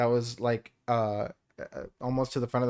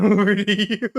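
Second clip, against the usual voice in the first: high, loud, breaking into laughter mid-phrase; helpless laughter over the words; laughing; 9 semitones higher, 8 dB louder than usual. Real, not acted. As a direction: crack up halfway through the line. Joke narration, cracking up.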